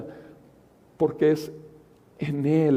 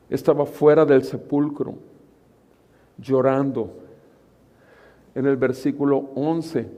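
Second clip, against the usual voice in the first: louder, declaiming; forceful preaching; 4 dB louder than usual. Acted. Preacher pointing congregation to John twenty, louder declaiming. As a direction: hammer each verse reference.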